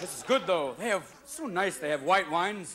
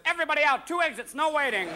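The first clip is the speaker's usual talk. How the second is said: higher, louder announcer-like call; body's alarm call; 8 semitones higher, 3 dB louder than usual. Retold or imitated. Your body reacting to cheap wine, higher and louder. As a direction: call it like a stewardess clearing the cabin. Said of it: "your body says".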